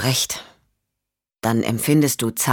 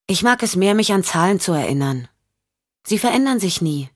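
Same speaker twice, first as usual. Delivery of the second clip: put-on higher-pitched little-girl voice; young girl; 5 semitones higher than usual. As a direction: play a self-assured child, pitched up. (höhere Mädchenstimme)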